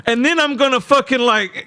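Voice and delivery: high mocking impression of a buddy; imitating a friend. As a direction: ham up his animated way of talking. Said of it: "your mama always does this thing where".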